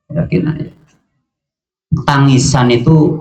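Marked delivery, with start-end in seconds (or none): 0.1-0.7 whispered repeat. Shown as a demonstration. Repeated term dropped to whisper.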